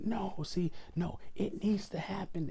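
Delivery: high-pitched mimicking of a coworker going off; mock agitated interruption. Coworker jumps in while he's telling her, mock agitated. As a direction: cut in with a high, worked-up mock voice.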